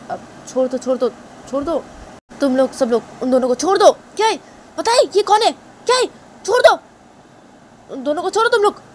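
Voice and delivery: high panicked shouting; frantic orders. Acted. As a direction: yell at servants to let go. Man orders servants, rising pitch.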